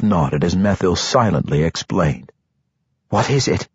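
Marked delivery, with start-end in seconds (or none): 3.1-3.7 higher, mildly demanding question. A narrator's reading. Matron Mother questioning her brother, demanding. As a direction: ask as if owed an answer. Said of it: "Matron Mother Quenthil demanded".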